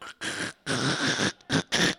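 harsh, broken bursts of scraping sound; scraping noise. Retold or imitated. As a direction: imitate a car scraping along a wall. Imitates car scraping along wall.

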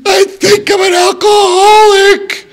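high, loud, slow crying confession; tearful confession. Retold or imitated. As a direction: break on the words, pleading. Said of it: "I'm like".

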